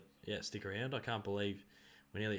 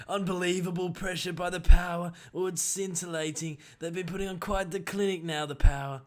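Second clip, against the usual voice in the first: higher, loud hammed-up commentator, big on unbelievable; Elliot Loney impression; 5 semitones higher, 7 dB louder than usual. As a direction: gush like a TV caller over the pressure. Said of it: "have a crack at what Elliot Loney does".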